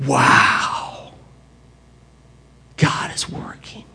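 soft, awed; hushed amazement; awed whisper. Real, not acted. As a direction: breathe it out in wonder. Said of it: "awed whisper".

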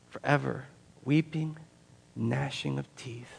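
soft, halting solemn warning; grave words spaced by pauses; hushed warning. Acted. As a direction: drop the volume and separate each phrase. Preacher repeats the grave warning.

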